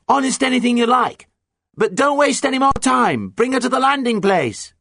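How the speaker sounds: high, loud, exasperated yell; impatient shouting. A narrator's reading. Pirate captain urges the boat to land, shouting impatiently. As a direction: shout it down, hurrying them along. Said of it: "shouted Nancy".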